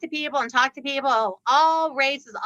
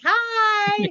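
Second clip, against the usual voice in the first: cheery hi; 8 semitones higher than usual. Retold or imitated. Waving back again from car.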